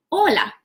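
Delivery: Hola said clearly, ending on a falling tune; a crisp, modelled "Hola". Shown as a demonstration. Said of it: "How do we say hello?".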